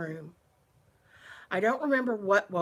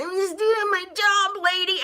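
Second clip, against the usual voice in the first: much higher, louder mock whine; mocking the exterminator; 12 semitones higher, 9 dB louder than usual. Exterminator brushing off the screaming woman, mock whiny and much higher. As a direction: sneer out his stock excuse. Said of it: "And he said again".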